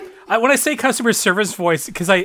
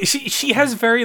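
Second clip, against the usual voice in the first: normal talking voice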